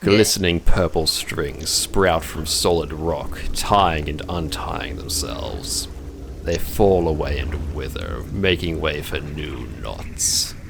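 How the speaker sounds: slow, low reading voice; reading a description. Acted. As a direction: read it out slowly like flavour text. Reads item description.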